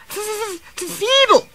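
very high stammer bursting into a loud word; outraged spluttering. A narrator's reading. Daisy interrupts the shunter's insult, spluttering. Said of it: "spluttered Daisy".

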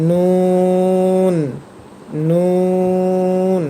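নুন drawn out with a held n; Arabic letter demo. Shown as a demonstration. Teacher drilling নুন for learners. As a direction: stretch নুন slowly. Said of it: "এর পরের হরফটি হবে".

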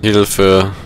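ordinary talk

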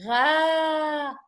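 gha as one drawn-out vowel; long a sound. Shown as a demonstration. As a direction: stretch gha into a sustained "ghaaa". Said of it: "If you have gha plus a".

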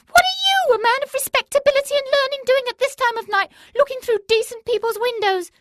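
very high, loud scolding hag voice, rushed; shrill crone. A narrator's reading. Crone catches the Faki at her window, shrill scolding. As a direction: shriek your outrage at the peeping holy man. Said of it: "she shrilled".

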